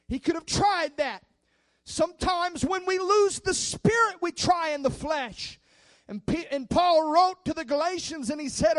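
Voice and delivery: loud, high, driving sermon voice; preaching at full force. Acted. Preacher urging the congregation not to give up, loud and fervent. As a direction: preach it out to the whole church, building and shouting.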